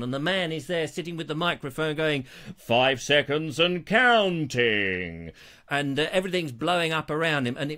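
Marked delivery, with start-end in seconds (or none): 2.6-5.3 slow, low, deep movie countdown voice; dramatic film countdown announcer. Retold or imitated. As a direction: intone it like a bomb timer voice. James Bond countdown announcer voice.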